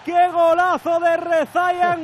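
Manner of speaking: loud, high drawn-out goal call; long goal yell. Acted. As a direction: hold every gol like a stadium cry. Commentator calls the goal.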